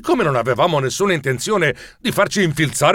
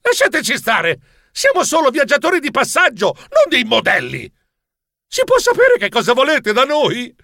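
high, exasperated yelling protest; fed-up mouse shouting; 10 semitones higher than usual. Acted. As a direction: snap at the pushy seamstresses. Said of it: "strillai esasperato".

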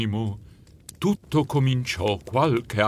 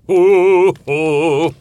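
loud, high ho-ho chuckle; a booming Santa laugh; jolly Santa; 5 semitones higher, 8 dB louder than usual. Acted. Santa chuckling at the generous mouse, loud.

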